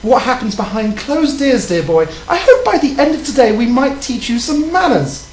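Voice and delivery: prim, proper British lady voice; British accent, ladylike; put-on British accent. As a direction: play a posh English lady flirting. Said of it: "Your accent seems to change".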